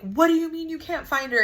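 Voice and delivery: high, alarmed question; mock-panicked what do you mean, pitched high; retold panic. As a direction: replay the moment the panic hit. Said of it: "of course, I'm panicking".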